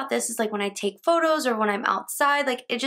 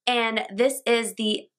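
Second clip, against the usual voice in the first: ordinary delivery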